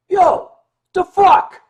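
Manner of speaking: loud, high New York bark; New Yorker blurting out a loud, high challenge; New York tough guy. Retold or imitated. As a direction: yell it like a brash New Yorker. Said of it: "do not speak New Yorkese".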